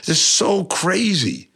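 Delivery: high, indignant retold outburst; outraged disbelief. Reading hateful Facebook comments, retelling his shocked indignant reaction. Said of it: "And I was like".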